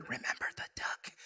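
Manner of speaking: sneaky breathless whisper. Retold or imitated. Sister secretly threatening her brother, whispered. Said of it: "And she whispered".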